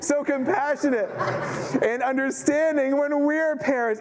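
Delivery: breaking into a laugh mid-phrase; can't keep a straight face; high, laughing through the words. Real, not acted. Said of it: "can't even say it with a straight face".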